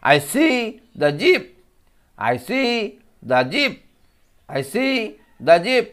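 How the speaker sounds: I see the jeep said slowly, jeep rising; teacher reading aloud; slow modelled sentence. Shown as a demonstration. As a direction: stretch it out for children to copy. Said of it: "আমার সঙ্গে শুনে শুনে তোমরা বলবে".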